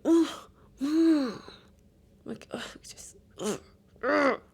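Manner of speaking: exaggerated disgusted groans; teen groan. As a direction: groan like an annoyed preteen. Imitating daughter's groans.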